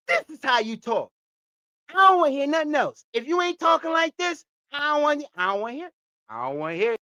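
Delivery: lightly hammed-up movie order, easing down at the end; insist, then let the voice drop away; Bad Boys movie impression. Finishing the Bad Boys quote for Anton, insistent impression. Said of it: "Just like in Bad Boys".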